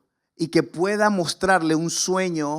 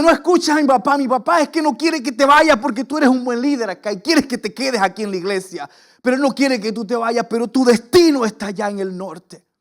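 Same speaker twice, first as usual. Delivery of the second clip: emphatic encouragement; 5 semitones higher, 5 dB louder than usual. Retold or imitated. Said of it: "Y me dice".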